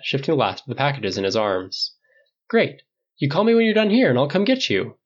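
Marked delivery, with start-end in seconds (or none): 2.5-5.0 Tony, much higher and breezy; Tony voiced high. A narrator's reading. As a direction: chirp the offer, pitched well up. Tony offering to pick up Steve.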